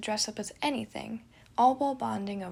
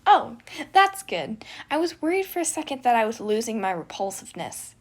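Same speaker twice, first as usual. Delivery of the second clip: loud, pleased with the insult; delighted gremlin; 2 semitones higher, 9 dB louder than usual. Acted. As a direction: revel in being called repulsive. Remus proud of insult.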